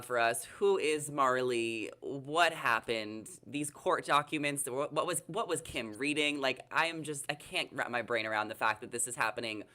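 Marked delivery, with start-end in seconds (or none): none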